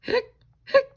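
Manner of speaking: high squeaky hiccup sounds, hic hic hic. Acted. Reading to kids, the skeleton hiccups in a high squeak. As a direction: pop each hic up high like a tiny hiccup.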